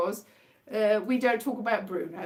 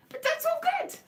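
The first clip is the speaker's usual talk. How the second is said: much higher, mock-sunny; ironic cheer. Real, not acted. To viewers after calling things terrible, ironic cheer. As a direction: squeak it out like all is fine.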